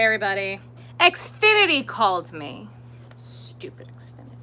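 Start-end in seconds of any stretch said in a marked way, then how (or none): 3.2-4.4 under-the-breath whisper of disgust; whispered grumble. Real, not acted. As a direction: hiss it to yourself, annoyed. Muttering to herself about Xfinity.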